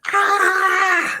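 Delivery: sudden high, loud yell while rushing forward; mock battle scream to scare off a stranger; charging war cry. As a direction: let out a wild scream as you charge at him. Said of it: "I just started running at him going".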